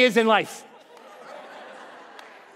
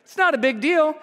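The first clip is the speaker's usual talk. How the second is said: ordinary talk, nothing marked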